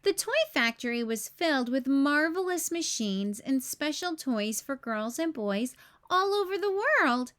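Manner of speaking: plain reading voice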